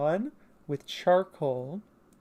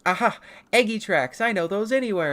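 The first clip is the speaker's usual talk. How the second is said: loud, high cartoon aha; excited discovery; 6 semitones higher, 6 dB louder than usual. Tay sniffing out tracks, excited discovery. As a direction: exclaim it like a detective finding a clue.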